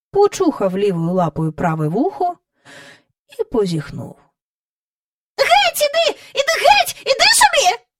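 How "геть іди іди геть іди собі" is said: very high, loud shrill shoo; furious shooing. A narrator's reading. Miss Lark furiously shoos the dog, very high and loud. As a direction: wave it off in a fury. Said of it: "люто махала на пса руками".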